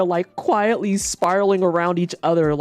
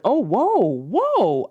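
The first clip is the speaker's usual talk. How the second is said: high rising real surprise; surprised whoa; 6 semitones higher, slower than usual. Real, not acted. Reading a new chat message, high real surprise. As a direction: caught off guard by a message.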